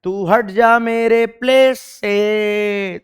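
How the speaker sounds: playful mock-dramatic call, very slow; playful molecule voice. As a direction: stretch each phrase's last vowel like a mock-dramatic chant. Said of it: "H ने बोला".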